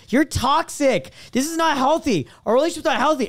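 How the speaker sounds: high mock scream of a drunk girlfriend; retold screaming. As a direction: girlfriend screaming accusations at five in the morning, high mock shriek. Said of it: "she's screaming at me".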